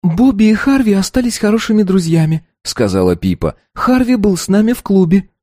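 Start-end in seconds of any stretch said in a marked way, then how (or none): 0.0-2.4 put-on high, light girlish voice; young girl voice. A narrator's reading. Narrator voices the daughter. 3.7-5.3 put-on high, light girlish voice; young girl voice. A narrator's reading. Narrator voices the daughter.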